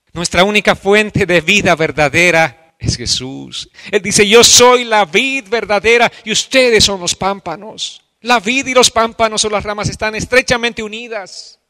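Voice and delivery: high, loud impassioned preacher's cry; a pastor crying out a prayer of repentance before the church; fervent preaching. Acted. Preaching at full force.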